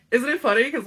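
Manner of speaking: ordinary delivery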